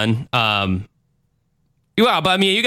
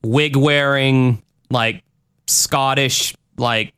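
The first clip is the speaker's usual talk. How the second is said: ordinary delivery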